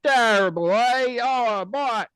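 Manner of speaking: very high, loud cartoon English accent; mock English accent. Imagined Man United fan complaining, squawky mock English accent. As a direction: wail like an outraged English fan, badly faked. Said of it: "I don't know why that's my English accent".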